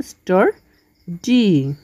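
স্টার্ডি drawn out slowly in two syllables, stor-di; careful classroom syllable demo; split into syllables. Shown as a demonstration. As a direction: sound out স্টার্ডি slowly, one syllable at a time. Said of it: "স্টার্ডিকে আমরা দুই ভাগে ভাগ করতে পারি".

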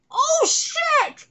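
high, loud startled cry; startled shout. Acted. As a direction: yelp it in shock. Sudden danger in game.

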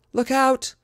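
high, loud cry of warning; shouted warning. Acted. Cornered fighter warns arriving rescuers, shouting loudly. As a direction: yell as loudly as you can. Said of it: "she cried as loudly as she could".

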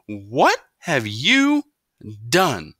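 very slow, high and loud horror; shocked gasp-question. A narrator's reading. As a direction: drag it out in disbelief. Mother sees conked hair.

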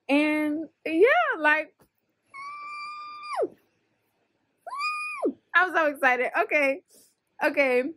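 loud, high, bubbly; excited. Real, not acted. Signing off to viewers, loudly excited. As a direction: burst with joy.

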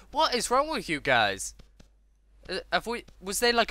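high, loud mock outrage; overexcited. Gamer confronting drunken townsfolk, overexcited mock indignation. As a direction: demand it loudly in a high voice. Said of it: "getting a little bit too excited".

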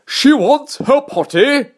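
high, slow rising call; drawn-out royal cry; king crying out. A narrator's reading. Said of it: "cried the king".

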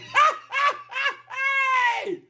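shrieking laugh; loud high laugh. Real, not acted. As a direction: burst into a high cackle.

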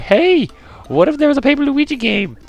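squeaky falsetto, very high; excited mock squeal, way up high; high-pitched self-impression. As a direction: imitate your past self in a silly falsetto. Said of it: "we were like".

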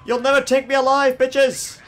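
high, loud, fast taunt; defiant shout. Pretend. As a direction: shout it like a movie outlaw. Fleeing cops, defiant.

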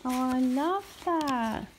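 mother thanking her son for his card; delighted gushing. Real, not acted.

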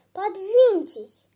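loud, curt demand to move over; cross boy. Acted. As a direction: order it crossly, like a sulky child. Cross boy orders old man.

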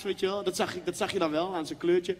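normal talking voice

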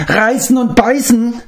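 high, whimpering question falling at the end; whimpering creature. Acted. Gurgi whimpers at Gwydion, high whimpering creature voice. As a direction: whine it like a frightened little beast. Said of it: "verdrehte die Augen und winselte".

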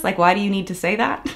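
normal talking voice

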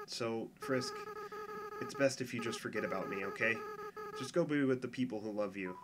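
hushed, sad goodbye to a friend; soft tearful farewell. Acted. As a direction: let the voice drop soft as he lets go. Tearful farewell before turning back.

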